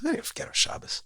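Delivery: hushed whisper; whispered aside. Real, not acted. Speaker muttering the truth to host, whispered. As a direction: drop to a whisper to undercut it.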